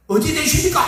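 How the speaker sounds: pressing for news of the date; lightly voiced Gyeongsang student asking eagerly; eager Gyeongsang roommates. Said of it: "경상도 사람들 막".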